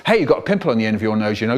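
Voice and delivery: rushed teasing aside; quick jibe to a chanting brother; fast teasing. Retold or imitated. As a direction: blurt it out fast to distract. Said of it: "I try to talk to him".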